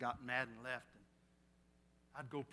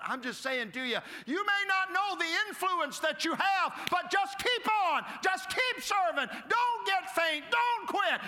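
much higher, much louder, stretched slow; preaching at full force; 12 dB louder than usual. Acted. Preacher urging the congregation to keep serving, at full force. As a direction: cry it out over the congregation.

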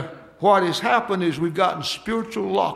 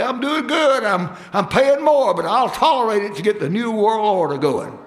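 mock-happy driver paying more, sarcastic; mock cheerful; 4 semitones higher, 4 dB louder than usual. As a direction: sarcastically pretend to be happy at the pump. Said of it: "next time you pull up to the pump, say".

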